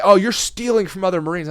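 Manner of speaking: loud, high scolding imitation; acted outraged accusing; mocking accusation. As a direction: imitate instructors making a huge deal of it. Instructors accusing him, acted.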